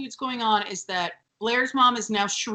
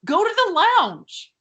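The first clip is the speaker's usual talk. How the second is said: high, loud comic scolding; fed-up, high-pitched outburst; exasperated mock shout; 12 semitones higher, 6 dB louder than usual. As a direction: raise your voice high as if ordering them out. Mock scolding arguers in public.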